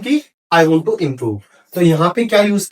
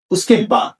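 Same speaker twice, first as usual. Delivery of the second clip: ordinary delivery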